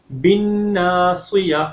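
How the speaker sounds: "بِالنَّاصِيَةِ" slow, with a long nasal hum on n; ghunna. Shown as a demonstration. Teacher demonstrates the rule on "بِالنَّاصِيَةِ", held ghunna. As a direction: hold the doubled n in "بِالنَّاصِيَةِ". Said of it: "ہمیشہ غنہ ہوتا ہے".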